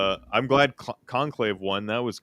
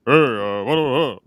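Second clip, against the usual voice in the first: put-on drunk [name] voice; 5 dB louder than usual. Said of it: "kinda like a drunk [name] voice".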